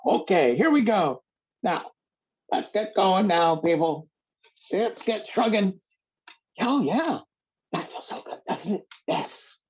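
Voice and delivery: rally the class like an eager teacher; hammed-up high-pitched workout cheerleader; Siddha impression. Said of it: "I'll do a little Siddha impersonation now".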